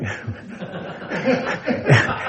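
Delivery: high, breathy chuckle; amused laugh. Real, not acted. Preacher amused by his thinking-room joke, chuckling. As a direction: laugh helplessly under your breath.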